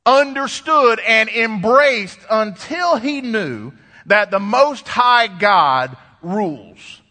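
slow, drawn-out sermon climax; emphatic preaching. Real, not acted. Preacher driving home God's rule to the congregation, slow and emphatic. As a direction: stretch each key phrase and let it ring out.

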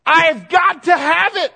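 loud, high, stretched-out rallying cry; hammed-up locker-room pep talk; fired-up team rally. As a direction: imitate a football player rousing his teammates. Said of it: "they were fired up".

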